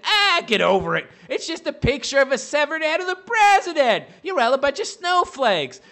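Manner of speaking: mocking the critics as snowflakes; mocking leftists. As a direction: sneer it like a smug pundit. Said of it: "which is them basically mocking us".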